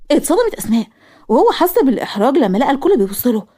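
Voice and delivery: plain reading voice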